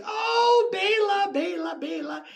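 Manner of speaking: very high mock-swooning wail, fading at the end; retold rapturous cry. Old lady exclaims over Bela Lugosi, high mock swoon. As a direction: wail the name high in imitation of her.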